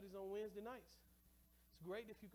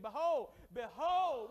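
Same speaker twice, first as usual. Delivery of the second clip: loud, strong Behold, Behold; mean it, call it out; said like you mean it; 4 semitones higher, 18 dB louder, much slower than usual. Shown as a demonstration. Pastor shows the church how to say Behold, loud. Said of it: "say it like you mean it".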